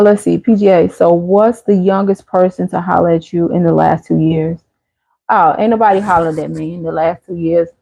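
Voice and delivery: normal talking voice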